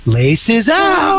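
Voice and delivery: lightly voiced shrill-free old mother; a hint of the movie mom; Ray Finkel's mom voice. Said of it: "Ray Finkel's mom voice".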